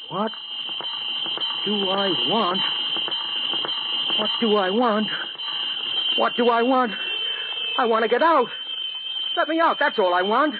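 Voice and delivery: begging to be let out; desperate outcry. Acted. Finally asked what he wants.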